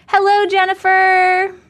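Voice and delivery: high, loud drawn-out hello; singsong greeting. Real, not acted. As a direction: stretch the hello like a song. Greeting a viewer in chat.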